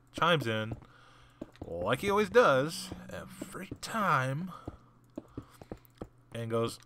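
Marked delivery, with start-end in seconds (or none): 1.7-4.5 drawn-out, higher and louder annoyance; slow exasperated emphasis. Real, not acted. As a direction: stretch it out, fed up. Exasperated about the buddy.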